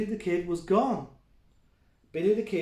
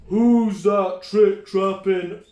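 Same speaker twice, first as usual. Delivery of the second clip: slow, loud troll voice; troll roar; 2 semitones higher, 10 dB louder, much slower than usual. Acted. Dad plays the troll to scare the kids, slow roar. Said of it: "roared the Big Tough".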